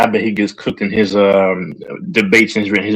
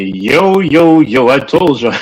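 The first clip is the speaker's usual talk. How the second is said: hype gloating; 5 semitones higher, slower than usual. Retold or imitated. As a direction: gloat like you called it. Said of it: "Infamous is probably gonna show up and say".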